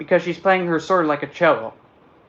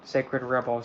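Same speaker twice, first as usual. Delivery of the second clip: ordinary talk, nothing marked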